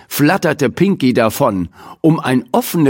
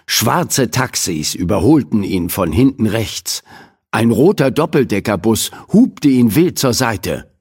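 ordinary delivery

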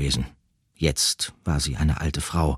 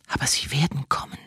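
whispering; 10 semitones higher than usual. Acted. Nebula nymphs warn old woman.

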